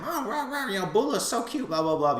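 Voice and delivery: high-pitched gabbing mom impression; mock a mother gushing on the phone; chatty mother. Bulma on the phone about her baby, chattering. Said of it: "but she's chatting".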